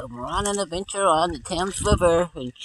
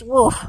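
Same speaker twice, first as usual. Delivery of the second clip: a loud, higher pup bark; louder woof; 6 semitones higher, 7 dB louder than usual. Pretend. Pup pointing out his partner, louder bark. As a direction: bark out happily.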